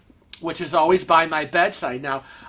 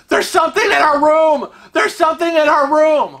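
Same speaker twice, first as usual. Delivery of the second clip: high, loud panicked yell; retold scream; 9 semitones higher, 5 dB louder than usual. Retelling waking husband, screaming in panic. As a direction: shriek it like something's loose in the room. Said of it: "And I start screaming".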